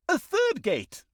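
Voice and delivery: spring the pun in a squeaky voice; high silly voice. Acted. Narrator springing a pun on the evil, in a squeaky high voice.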